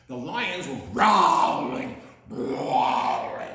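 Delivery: loud growly lion voice on the repeated growling. Acted. Reading to kids, growling like a lion. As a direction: let growling rumble like a lion.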